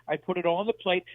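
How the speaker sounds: ordinary delivery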